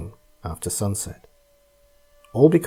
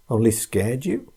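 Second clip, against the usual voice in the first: cold, dismissive question; unsympathetic. Acted. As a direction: ask without sympathy.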